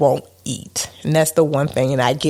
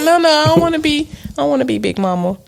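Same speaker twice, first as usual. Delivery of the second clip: laughing protest; 11 semitones higher, faster than usual. Real, not acted. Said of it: "I thought she was the character".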